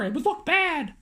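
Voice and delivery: ordinary delivery, nothing marked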